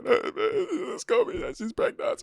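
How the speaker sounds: high, slow, drawn-out wail; hammed-up sob while telling his boys the news; mock crying. Mimicking his own sobbing to his boys. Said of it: "see me crying".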